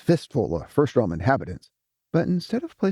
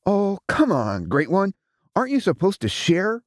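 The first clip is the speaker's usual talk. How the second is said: angry; 8 semitones higher than usual. A narrator's reading. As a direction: fire it at him angrily. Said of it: "he fired angrily".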